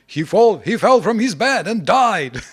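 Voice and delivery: loud, high, hammed-up proclamation; mock alarm. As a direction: cry it out like a sensational headline. Mock dramatic report of absurd death.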